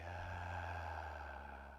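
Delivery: long, soft, audible sigh out; big sigh. Shown as a demonstration. Teacher leading students in deep breaths, big sigh. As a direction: let out a long releasing breath. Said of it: "Big sigh".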